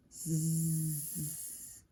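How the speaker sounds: long buzzing "zzz"; drawn-out sleeper's buzz; snoozing sound. A narrator's reading.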